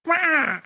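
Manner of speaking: sudden high-pitched eagle squawk; eagle cry. Retold or imitated. Imitating the eagle calling him to follow. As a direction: mimic the eagle calling out sharply. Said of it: "He goes".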